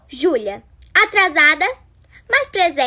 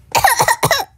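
cough; 9 semitones higher than usual. Acted. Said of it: "minha rinite atacou".

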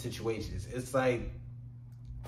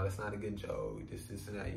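softer, a quiet firm correction; lightly voiced own remark, softer; quoting himself softly; 4 semitones lower, 9 dB quieter than usual. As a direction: say it low and matter-of-fact. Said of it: "there's times where I say".

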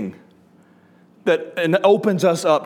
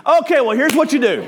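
higher, louder, laughing eagerness; relieved eagerness; 10 semitones higher, 4 dB louder than usual. Retold or imitated. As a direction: jump at the chance to fix it. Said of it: "relieved switch to advice".

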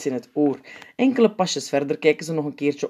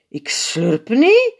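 high, loud, slow protest; indignant protest; 8 semitones higher, 8 dB louder, much slower than usual. A narrator's reading. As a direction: deny it slowly. Strooipiet protesting.

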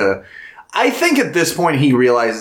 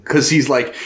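ordinary talk, nothing marked

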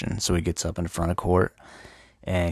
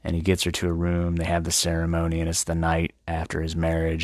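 ordinary talk

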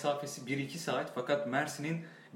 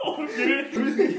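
laughing tease; 11 semitones higher, 6 dB louder, slower than usual. Real, not acted. Teasing friend mid laugh.

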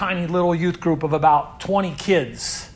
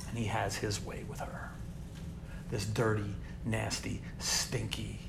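1 hushed and grave; 6 semitones lower, 15 dB quieter than usual. Real, not acted. Grim detail of child's abuse.

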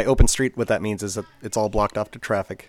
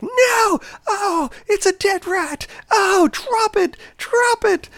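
high, loud, slow mock wail; panicked lady; 6 dB louder, much slower than usual. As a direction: plead with the dog in horror. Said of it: "And the lady was like".

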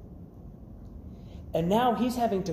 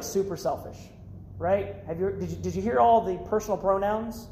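ordinary delivery

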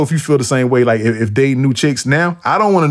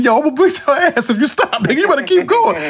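retold yell; 13 semitones higher than usual. Yelling at friend to keep driving.

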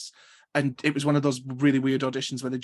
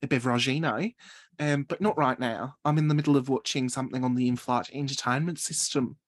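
put-on playful improvised Australian accent; copied Australian accent. Passenger busy with in-flight entertainment, imitated Australian accent. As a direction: carry on the polite refusal in Australian. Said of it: "That was so good".

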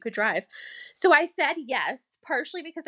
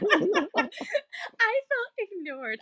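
laughing meekly; 9 semitones higher, much slower than usual. Retold or imitated. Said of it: "And then I probably was like".

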